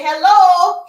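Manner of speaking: high, very loud drawn hello; booming greeting. Acted. God jumping out of the sky, booming hello. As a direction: burst out with a big mock announcement.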